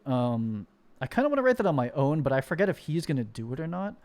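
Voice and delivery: ordinary talk, nothing marked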